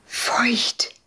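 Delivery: hissed under the breath; hissed whisper. A narrator's reading. Tenant warns Ewke about the flat, hissing it. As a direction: whisper it sharply, like a secret warning. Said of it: "zischelte ihr die Mieterin zu".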